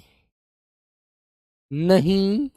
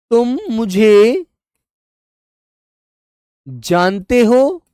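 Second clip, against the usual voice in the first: slow dictation; 3 semitones higher, slower than usual. Shown as a demonstration. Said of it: "दूसरा वाक्य हम कहेंगे".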